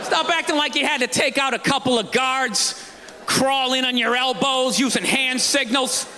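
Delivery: ordinary talk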